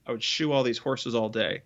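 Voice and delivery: ordinary delivery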